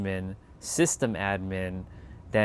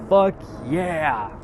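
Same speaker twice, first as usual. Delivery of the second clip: mock triumphant cheer; 5 semitones higher, 4 dB louder than usual. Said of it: "I was like".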